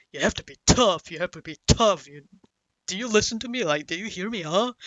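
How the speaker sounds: loud mock scolding, climbing in pitch; berating father. Father lecturing his kid in the dark motel, berating. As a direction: imitate a nagging parent hammering out advice. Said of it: "in the same fucking tone".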